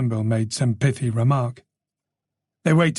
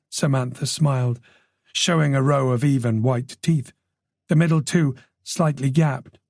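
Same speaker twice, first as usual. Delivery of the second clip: plain reading voice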